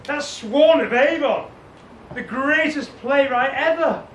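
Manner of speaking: ordinary talk, nothing marked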